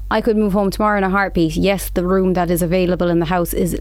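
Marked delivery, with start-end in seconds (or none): none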